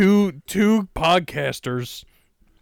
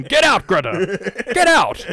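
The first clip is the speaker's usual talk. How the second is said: higher, louder yelling at Greta; mock yell; 5 semitones higher, 4 dB louder than usual. Shouting at Greta to leave the room, loud mock yell. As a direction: shoo her out with a yell.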